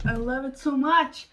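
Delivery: usual speaking voice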